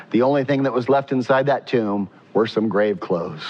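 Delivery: ordinary talk, nothing marked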